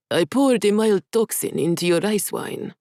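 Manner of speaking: a smug, changed man's voice; a woman impersonating a man to deceive; in Adrian's voice. Acted. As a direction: confess it as the villain would. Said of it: "Jia said in Adrian's voice".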